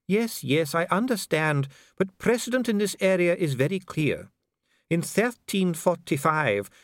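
a bureaucrat soothing a prisoner; calm official Dee. A narrator's reading. Dee answering imprisoned Vimes, coolly polite.

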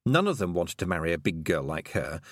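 plain reading voice

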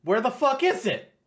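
loud, high-pitched demand; exasperated outburst. Real, not acted. Co-host challenging writer over a missing pose, exasperated outburst. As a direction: demand an answer, voice jumping up loud.

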